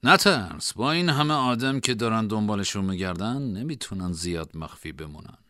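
calm companion. A narrator's reading. Reassuring companion voiced.

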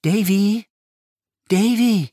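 put-on a boy calling his name through the keyhole; child's hushed call. Young David calls Peggotty's name at the keyhole, high and hopeful.

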